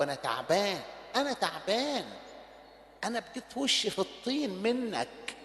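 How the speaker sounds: soft, low, broken-hearted plea; weary prayer. Acted. Preacher voicing a tired sinner's prayer to God, soft and weary. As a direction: pray it quietly like an exhausted son.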